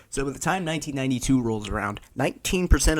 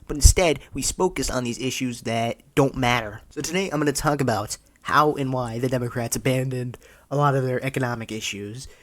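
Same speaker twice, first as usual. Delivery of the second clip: usual speaking voice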